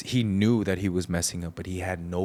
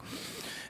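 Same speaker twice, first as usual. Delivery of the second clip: heavy breathy exhale, imitating someone; imitated sigh; 13 dB quieter than usual. Man imitating his uneasy dad at their meeting, audible sigh. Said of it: "and he's sighing".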